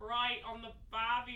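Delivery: loud hammed-up Australian accent. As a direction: shout it in a mock Australian accent. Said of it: "Someone do a Hugh Jackman right now".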